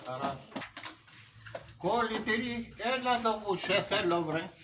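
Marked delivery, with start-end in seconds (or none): 1.8-4.5 calling out. Acted. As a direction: summon the kid from afar. Father calling his son over.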